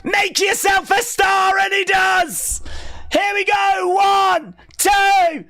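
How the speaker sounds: shouted high play-by-play; wrestling commentator. Real, not acted. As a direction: yell the big move like a hyped commentator. Hyped live wrestling call.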